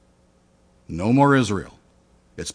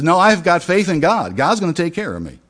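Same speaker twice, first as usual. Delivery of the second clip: stubborn refusal; 6 semitones higher, 3 dB louder than usual. Acted. Stubborn man refusing rescue again.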